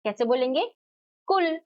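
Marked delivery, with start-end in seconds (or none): 1.3-1.6 "कुल" with a brief u, pitched high; short उ. Shown as a demonstration. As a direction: keep the vowel in "कुल" short. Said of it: "छोटे ऊ को भी नहीं खींचते".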